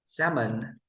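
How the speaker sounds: Salmon modelled as "SAM-un"; silent L in salmon. Shown as a demonstration. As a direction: say Salmon with the L dropped. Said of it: "We say salmon".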